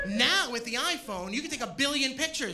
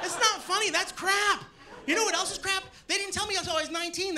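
indignant shout; 9 semitones higher than usual. Real, not acted. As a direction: shout it out in comic outrage. Indignant rant at crowd.

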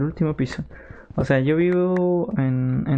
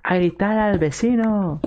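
imitating parents; 6 semitones higher than usual. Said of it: "Y mis padres me dijeron".

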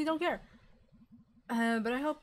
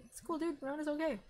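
higher, brighter reassurance to herself; self pep talk; 5 semitones higher than usual. Shown as a demonstration. May reassuring herself in the mirror, higher and lighter. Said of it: "I'm being body positive".

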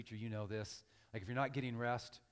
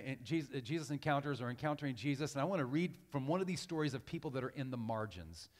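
ordinary talk

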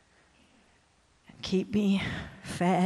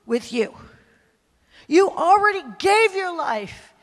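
furious rebuke; 6 semitones higher, 5 dB louder than usual. Retold or imitated. God rebuking her for refusing to go. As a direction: thunder it down like a stern God.

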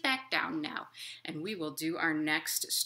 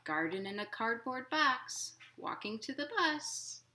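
gentle, lilting picture-book reading; reading to children; 3 semitones higher than usual. A narrator's reading. Librarian reading a picture book to children, lilting. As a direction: read it like storytime for little kids.

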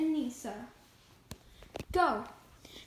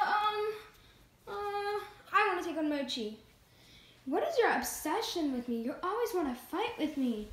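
ordinary talk

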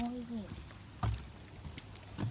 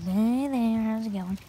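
loud, drawn-out greeting as a pretend frog; 9 dB louder, slower than usual. A little frog greets the night walker, in a loud pretend voice. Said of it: "He's like".

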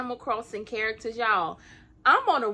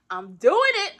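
louder and higher, proudly declaring "doing"; proud declaration; 7 semitones higher, 5 dB louder than usual. Real, not acted. Telling viewers she's doing it, proud and loud. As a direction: announce it like a win.

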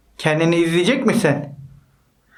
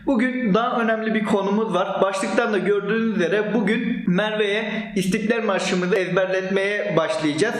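usual speaking voice

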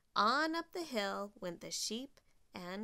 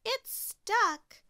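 high, loud exclamation; raised, excited call; Lil's cry; 12 semitones higher, 7 dB louder than usual. A narrator's reading. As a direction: cry out in urgency. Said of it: "cried Lil".

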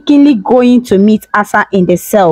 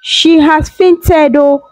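higher, panicked cry for help; screaming for help; 7 semitones higher than usual. Acted. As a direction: scream for help, stretching the words. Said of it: "she screamed".

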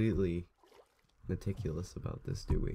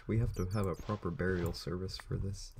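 ordinary talk

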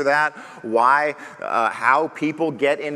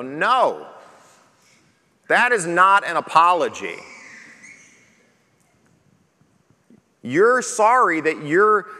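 firm, louder correction with slow, weighty pauses; a hint of a stern dad; stern parent; 3 semitones higher, 4 dB louder, slower than usual. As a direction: refuse the half-hearted apology. Parent correcting child's apology.

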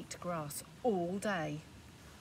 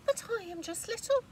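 much higher, small pleading voice; pleading little goat; 14 semitones higher, 4 dB louder than usual. Acted. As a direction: beg to be spared. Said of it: "said the little billy goat Gruff".

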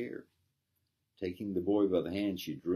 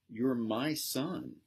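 slow, high cry of joy; mother finding her lost son, slow tearful cry; cried out; 7 semitones higher, much slower than usual. A narrator's reading. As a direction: draw out each word, overcome. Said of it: "cried the Countess".

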